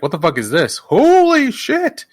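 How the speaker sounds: high, drawn-out astonishment; amazed reaction. Retold or imitated. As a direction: relive being blown away, stretching it out. Acting out own amazed reaction.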